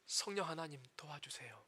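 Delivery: soft, low plea for help; quiet prayer. Shown as a demonstration. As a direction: pray it quietly, like a plea under the breath. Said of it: "뭐라 해야 됩니까". (낮고 조용하게 간절히 드리는 기도)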